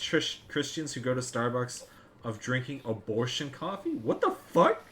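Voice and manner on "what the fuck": shocked disbelief. Real, not acted. Shocked by abortion coffee claim.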